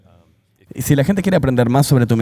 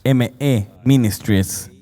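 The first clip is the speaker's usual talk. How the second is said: slow spelling; slower than usual. Shown as a demonstration. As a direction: spell out K O O M E one letter at a time, slowly. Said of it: "se deletrea". (deletreo lento, letra por letra)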